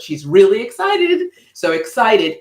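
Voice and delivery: high, loud burst of excitement; really excited. Shown as a demonstration. Speaker demoing an excited face for stage use, loud and high. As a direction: show pure enthusiasm. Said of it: "up, enthusiasm".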